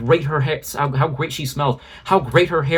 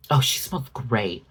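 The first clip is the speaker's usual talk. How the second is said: softer, put-on gushing voice; softer mock fan gushing; 3 semitones lower, 5 dB quieter than usual. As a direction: breathe it like a dreamy fan.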